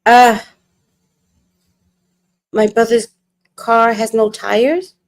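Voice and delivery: dumbfounded, long gaps between fragments; stunned realisation. Acted. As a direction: stammer in dawning disbelief. Acted stunned realisation about the tires.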